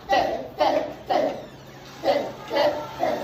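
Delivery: "T" as an unvoiced "tuh", not the letter name; letter-sound T. Shown as a demonstration. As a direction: tap each "T" as a brief voiceless burst. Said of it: "Qual é o som da letra T".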